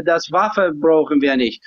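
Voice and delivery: usual speaking voice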